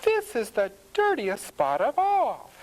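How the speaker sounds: high, dismayed farmer's wife; crying out. A narrator's reading. Farmer's wife at the dirty pig pen, crying out. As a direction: exclaim in a high housewife voice. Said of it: "cries the farmer's wife".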